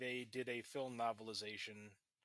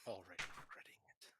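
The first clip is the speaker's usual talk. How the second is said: whispering; 6 semitones lower, 9 dB quieter, faster than usual. Real, not acted. Rueful reply to co-host.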